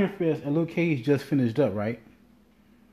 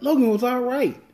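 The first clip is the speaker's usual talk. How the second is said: much higher, indignant repeat of his words; incredulous echo; 11 semitones higher than usual. Retold or imitated. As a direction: throw it back in high-pitched disbelief. Incredulous echo of dad's lukewarm verdict.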